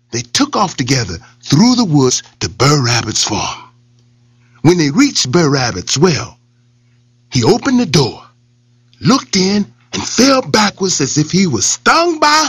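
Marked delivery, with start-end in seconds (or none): none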